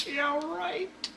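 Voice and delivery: imitating a scoffing woman, very high squeaky laugh; mocking laughter. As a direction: laugh it out in falsetto, disbelieving. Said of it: "why is your wife laughing at me".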